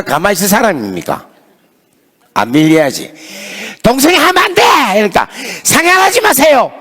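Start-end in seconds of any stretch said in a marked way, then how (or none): 3.8-4.6 much higher, forceful no; retold firm retort. Pastor arguing back with students, high forceful insistence. 5.6-6.7 much higher shouted plea; retold shout. Students shouting at the pastor to back off, high shout.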